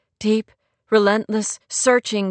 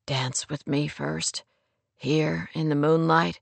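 romantic male lead; 5 semitones lower, 5 dB quieter than usual. Acted. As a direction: invite her softly in his lower voice. Man invites woman to dance.

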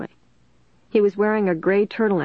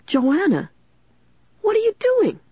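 very high, strained shout; 13 semitones higher than usual. A narrator's reading. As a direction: call out in outraged alarm. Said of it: "he shouted".

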